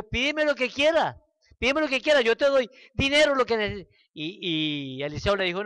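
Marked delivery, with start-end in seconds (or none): 0.0-3.9 eager grateful king. Retold or imitated. Grateful king offers prophet anything.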